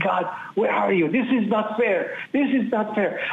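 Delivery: retold anguished plea. Anguished prayer after brother's execution.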